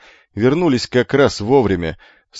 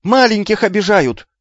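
high, loud wail; shrill cry for help; 13 semitones higher, 5 dB louder than usual. Acted. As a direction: shriek that the little ones are hurt. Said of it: "и добавил главное".

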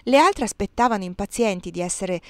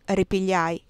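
ordinary delivery

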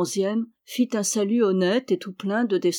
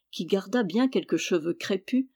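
ordinary delivery

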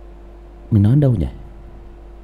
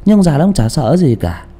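ordinary talk

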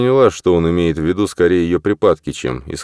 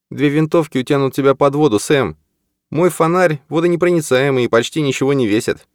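higher female character voice; Maggie voice; 10 semitones higher than usual. Acted. Maggie's lines in the story.

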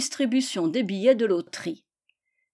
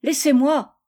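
higher, louder acted shout; impatient cry; 5 semitones higher, 6 dB louder than usual. A narrator's reading. Gisèle tries to escape the pestering children, impatient cry. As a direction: cry out to be left alone. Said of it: "cria Gisèle".

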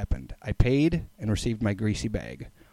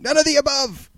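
mock scream. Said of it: "trick me into screaming".

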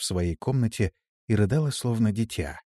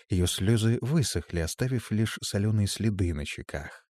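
ordinary delivery, nothing marked

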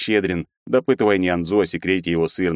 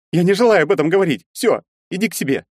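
high, louder and quick; upset refusal; 12 semitones higher, 5 dB louder than usual. Acted. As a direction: cut him off and send him away.

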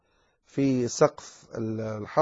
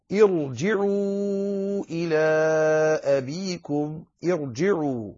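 slow tajweed chant, long vowels; Quranic recitation; slower than usual. Shown as a demonstration. Teacher reciting the verse correctly, slow tajweed chant. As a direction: recite slowly with a heavy ra and held elongations.

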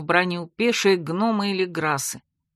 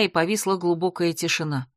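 ordinary delivery

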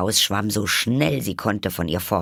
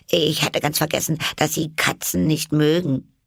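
put-on high-pitched, contrite girl's voice; apologetic Alice; 9 semitones higher than usual. A narrator's reading. Alice apologises for mentioning cats, apologetic. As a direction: excuse yourself in a higher voice.

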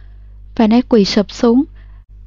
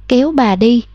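ordinary delivery, nothing marked

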